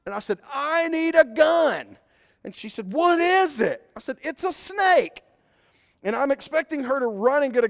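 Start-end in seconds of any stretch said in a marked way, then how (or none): 0.5-2.0 high, loud, slow "I need a gun"; urgent call. Retold or imitated. Husband who found a snake calling to his wife, urgent shout. 2.9-3.9 high, loud questioning shout; wife calling back. Retold or imitated. Wife on the deck shouting to husband, curious high call. 4.2-5.2 high, loud "It's a snake"; urgent call. Retold or imitated. Husband calling the snake out to his wife, high urgent shout.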